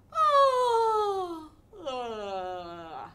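a hammed-up sobbing groan; mock weary groan. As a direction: groan like a bored duck. Mama duck sighing sob.

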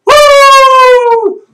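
high, ringing "woo"; loud whoop. Shown as a demonstration. Fan showing off his loud voice, whooping.

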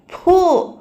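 one loud, high blown "phoo"; big voiced puff; blowing puff. A narrator's reading. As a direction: blow hard at a bug up close. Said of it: "అంటూ మళ్ళీ ఊదాడు".

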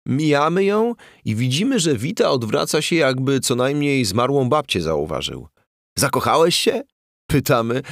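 high-pitched, worked-up comic storytelling; excited barroom raconteur, pitch leaping up; animated anecdote. Acted. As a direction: build to the punchline in incredulous bursts. Character tells mates a hilarious anecdote.

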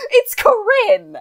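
high, loud and drawn out; helplessly amused wail; laughing exclamation. Real, not acted. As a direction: cry it out slowly through laughter. Laughing defence of the character.

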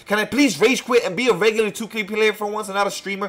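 raging. Real, not acted. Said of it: "let me just rage quit".

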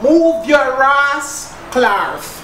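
very slow, broad Jamaican patois. Acted. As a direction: drag out the patois insult slowly. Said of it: "let me put it away, you might understand".

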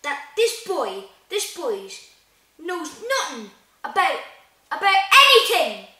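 outrage building to a high shout; emphatic and loud, exploding on the last word; angry growl. A narrator's reading. Hagrid confronting the Dursleys about the boy, growling into a shout. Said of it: "he growled at the Dursleys".

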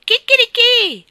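very high, loud, drawn-out cock-a-doodle-doo; piercing child's cockcrow; shrieked rooster crow. A narrator's reading. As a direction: crow at full pitch like a rooster. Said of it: "strillò Isabel".

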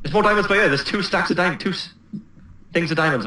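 high, eager report of a find; bursting to share the discovery; excited. Real, not acted. Real find of diamonds in game.

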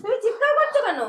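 high-pitched, anxious questioning; alarmed. Acted. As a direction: rush in alarmed at a scream. Runs in after mother's scream. (আতঙ্কিত চড়া গলায়)

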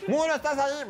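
sing-song it like the big bad wolf. Wolf calling to the wall, mock singsong. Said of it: "es como el lobo".

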